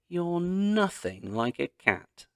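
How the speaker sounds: high, slow declaration; declaring. A narrator's reading. Girl telling the rabbit she's nothing like a cat, slow declaration. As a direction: state it as a verdict. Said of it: "Katya declared".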